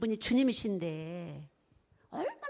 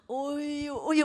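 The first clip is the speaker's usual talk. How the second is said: put-on cooing at a baby; 5 semitones higher, 5 dB louder, slower than usual. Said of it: "막 이러잖아요".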